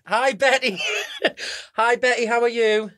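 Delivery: bright high greeting. Real, not acted. Greeting friend on loudspeaker.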